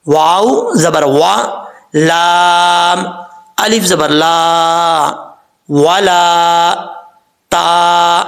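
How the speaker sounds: slow chanted spelling of ولتت letter by letter; spelling recitation. Shown as a demonstration. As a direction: chant each letter and vowel slowly. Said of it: "ہجے کر لیتے ہیں". (حروف کے ہجے آہستہ لحن کے ساتھ)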